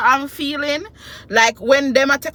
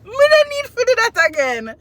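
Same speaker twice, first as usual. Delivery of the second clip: high, crying voice; half-laughing, half-crying; tearful; 11 semitones higher than usual. Real, not acted. Mother just after birth, crying with relief.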